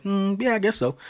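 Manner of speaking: high, hesitant, half-hearted yes; reluctant agreement. Retold or imitated. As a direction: agree against your better judgment. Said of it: "But in this case, I said".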